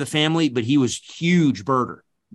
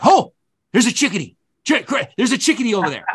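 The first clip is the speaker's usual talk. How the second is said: high, louder burst of mock discovery; excited birder; 8 semitones higher, 4 dB louder, faster than usual. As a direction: imitate an excited birdwatcher calling out a sighting. Retold birder excitedly spotting a bird.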